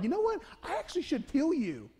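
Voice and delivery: mock-menacing Balaam deciding to kill the beast; sudden threat. Preacher voicing Balaam as he threatens his donkey, mock menace. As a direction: pitch leaps up on the first words, then a menacing turn.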